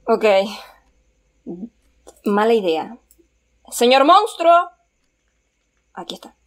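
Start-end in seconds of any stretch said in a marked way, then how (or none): 3.7-4.7 high, loud, slow call; singled-out, raised calling voice. Real, not acted. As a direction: call into the dark for someone. Calling out to the monster.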